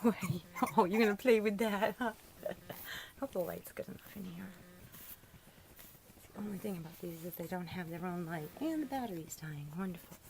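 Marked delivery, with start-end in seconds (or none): none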